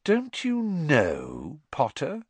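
slow, drawn-out taunt, pitched high; mock-incredulous, stretched-out question; breathed taunt. A narrator's reading. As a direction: savour a secret over a rival. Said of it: "breathed Malfoy".

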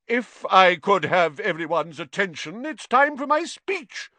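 loud. A narrator's reading. Nick demanding attention for his speech, loudly. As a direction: call the room to attention loudly. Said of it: "said Nearly Headless Nick loudly".

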